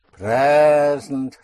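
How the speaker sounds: "present" with a loud, long push on PRE; present tense push. Shown as a demonstration. Teacher demonstrates the present tense push, heavy drawn-out stress. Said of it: "a present tense push".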